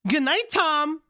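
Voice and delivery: voicing Rowan. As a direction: play her sweet and high. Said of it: "do you wanna be Rowan".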